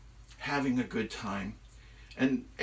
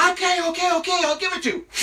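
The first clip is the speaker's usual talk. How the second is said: high, loud frantic yelping; panicked surrender; 9 dB louder, much faster than usual. Acted. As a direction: give in desperately mid-fall. Falling Loki gives in.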